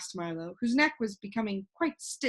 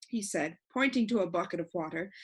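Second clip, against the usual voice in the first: usual speaking voice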